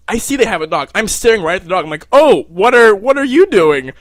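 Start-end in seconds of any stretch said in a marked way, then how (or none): 2.1-3.9 raised, louder, strained casual tone; a jittery, forced-friendly blurt at a stranger's dog; awkward nervous question. Retold or imitated. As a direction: fake being casual while panicking. Nervous small talk with the girls.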